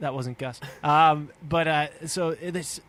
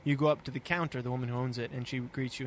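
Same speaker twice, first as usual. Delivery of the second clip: ordinary talk, nothing marked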